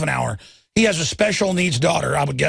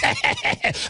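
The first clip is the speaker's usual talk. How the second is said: high airy villain cackle; breathy high mocking cackle; mock evil laugh; 4 dB quieter than usual. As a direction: cackle like a gloating cop. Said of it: "He's like".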